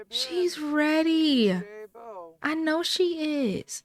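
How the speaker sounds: slow, stretched-out assurance; emphatic insistence. Acted. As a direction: draw out each word to insist. Mother insists her daughter is ready.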